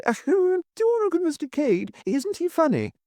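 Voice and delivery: very high squealing gush; squealing delight. A narrator's reading. Miss Taylor swooning over her guide, high squeal. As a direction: shriek with giddy delight. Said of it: "screamed with laughter".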